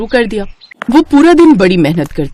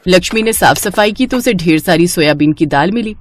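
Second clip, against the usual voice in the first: ordinary delivery, nothing marked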